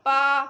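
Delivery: पा called out loud and crisp; loud letter sound. Shown as a demonstration. Teacher to young learners, sounding out पे: loud पा. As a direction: pop a loud पा for the class. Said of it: "पे से पंखा".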